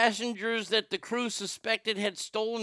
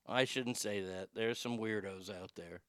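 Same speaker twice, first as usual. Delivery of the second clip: low, hushed self-correction; muttered aside; 10 semitones lower, 12 dB quieter than usual. Real, not acted. Host walks back his sweeping claim, quiet muttered aside. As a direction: drop low and soft, as if to yourself.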